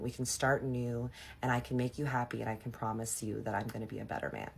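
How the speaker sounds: soft, subdued retold proposal; earnest plea. Imitating her ex on one knee proposing, earnest plea. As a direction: drop into a quiet, sincere appeal.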